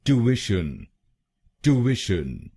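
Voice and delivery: ट्यूशन "too-ish-un" at normal pace; American pronunciation. Shown as a demonstration. Teacher repeating the American model at normal speed. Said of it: "American English में इसको बोलेंगे".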